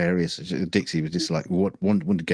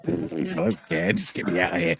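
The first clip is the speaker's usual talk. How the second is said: bored dog voice; 9 semitones higher than usual. Retold or imitated. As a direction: drag it out like a fed-up dog. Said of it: "And Dixie was like".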